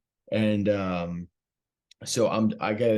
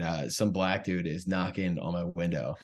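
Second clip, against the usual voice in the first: ordinary talk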